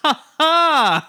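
loud, high villain "ha ha"; Colbert character laugh. Retold or imitated. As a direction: boom it out like a cartoon rogue. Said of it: "the character that Stephen Colbert played".

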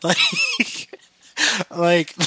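amused, laughing at your own story. Real, not acted. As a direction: break into a high laugh, then talk through it. Laughing after own acted story.

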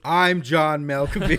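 loud, slow celebrity impression; hammed-up, drawn-out actor voice; John Malkovich. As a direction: draw it out, loudly self-announcing. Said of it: "he's just talking like John Malkovich".

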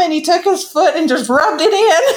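amused storyteller chuckling through the line; laughing. Real, not acted. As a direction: giggle as you tell the punchline. Laughing while telling grandpa's prank.

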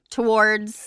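"towards" said slowly and carefully in two syllables; deliberate pronunciation. Shown as a demonstration. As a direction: draw out "towards" as if trying the word on. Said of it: "my, I'll change towards".

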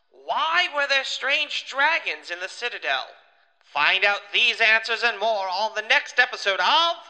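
big booming cartoon announcer; loud, high teaser announcer voice; booming kids show opener. Next episode teaser announcer.

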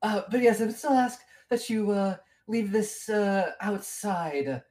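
high, hesitant character voice, slowing at the end; gnome mayor. Acted. Mayor asking visitors to leave it outside, hesitant. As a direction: politely insist in a high mayor's voice.

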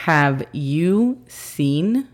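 very slow careful English demo; teacher model, very slow; slow model phrase. Shown as a demonstration. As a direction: stretch each word clearly. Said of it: "pero sería".

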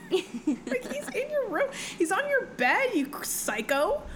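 high, exasperated mock scolding. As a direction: scold her in a high, incredulous voice.

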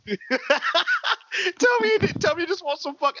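high laughing through words; breaking up with laughter. Real, not acted. Laughing heavily.

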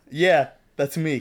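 says it through a laugh; light laughing reply. Acted. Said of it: "he laughed".

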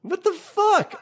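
high shocked exclaim; incredulous. Real, not acted. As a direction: gasp it out in disbelief. Continued disbelief.

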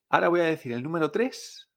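ordinary talk, nothing marked

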